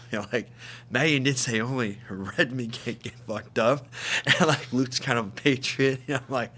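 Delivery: ordinary delivery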